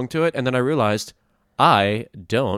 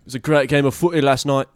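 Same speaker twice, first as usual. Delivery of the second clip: louder Scouse "footy" line; Liverpool accent; 4 semitones higher, 6 dB louder than usual. Shown as a demonstration. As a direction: boast about the footy in Scouse. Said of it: "they say footy".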